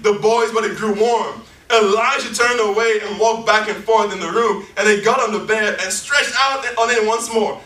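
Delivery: booming scripture reading, every phrase pressed; preaching scripture aloud. Acted. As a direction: proclaim the verses to the congregation.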